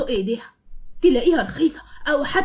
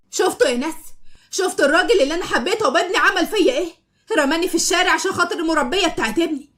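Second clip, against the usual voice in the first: high, loud wail to the neighbours; loud public outcry; 6 semitones higher, 3 dB louder than usual. Acted. Malak shouting to the neighbours from the door, loud and shrill. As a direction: make a scene so the whole street hears. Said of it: "بتتكلم بصوت عالي".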